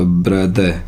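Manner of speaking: ब्रदर as "bra-duh", R dropped; silent final R. Shown as a demonstration. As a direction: end ब्रदर on an open vowel. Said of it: "ईआर का उच्चारण नहीं होता".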